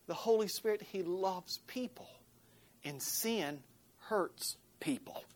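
soft, slow unvoiced whisper. Real, not acted. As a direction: lean in and whisper it. Pastor drops to intimate whisper.

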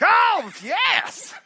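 high, loud mock-ecstatic cry; imitated praise shout. Imitating Pentecostals who praise at anything, high shout. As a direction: whoop it like an excitable worshipper. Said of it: "they'll just shout".

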